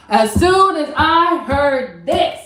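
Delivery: "as soon as I heard this" high and drawn out; slow right down and lift every word of "as soon as I heard this"; dramatic emphasis. Real, not acted. Telling viewers the turning point, dramatic emphasis on "as soon as I heard this". Stresses the moment she heard.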